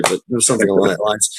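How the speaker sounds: usual speaking voice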